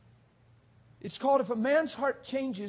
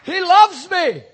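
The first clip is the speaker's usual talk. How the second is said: joyful shout; 8 semitones higher, 11 dB louder, slower than usual. Real, not acted. Joyful proclamation.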